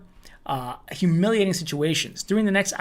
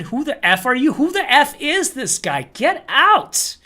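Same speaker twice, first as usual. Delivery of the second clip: acted-out angry retort, building louder; mock outrage rising to a loud dismissal; higher, incredulous, ending in a shout; indignant outburst; 6 semitones higher, 5 dB louder than usual. Acting out group member's hostile response.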